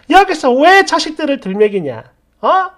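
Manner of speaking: enraged shouted challenge; great rage. Acted. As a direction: explode at the insult to your children. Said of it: "대분노를 해요".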